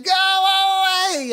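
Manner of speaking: very high, drawn-out shout; long wailing dismissal; fed-up yell. A narrator's reading. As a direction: holler the visitor away. Woken cats yell at the dog.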